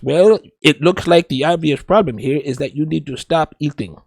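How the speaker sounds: put-on Iranian-Texan accent, pointing out the obvious; deadpan doctor with Iranian accent and Texas twang; Doctor Now. Doctor pointing out the obvious to a patient, Iranian-Texan accent. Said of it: "there's my impression of Doctor Now".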